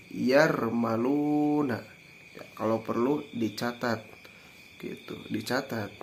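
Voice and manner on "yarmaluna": slow, drawn-out "Yarmaluna"; mnemonic recitation. Shown as a demonstration. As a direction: stretch out the vowels of "Yarmaluna". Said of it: "Dikumpulkan dalam sebuah kalimat".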